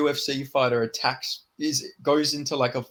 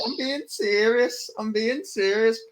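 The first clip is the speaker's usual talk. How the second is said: high, slow Scouse impression; Darren Till; 9 semitones higher, slower than usual. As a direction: repeat it slowly in a high mock-Scouse accent. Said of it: "I can only say".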